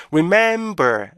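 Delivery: "remember" with long, drawled syllables; overstretched REMEMBER. Shown as a demonstration. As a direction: drag out the vowels of "remember". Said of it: "이렇게 읽는 경우가 많아요".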